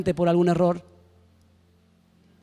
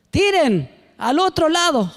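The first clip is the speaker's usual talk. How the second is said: calling out; 6 semitones higher, 3 dB louder, slower than usual. Acted. Voice from shore calling order.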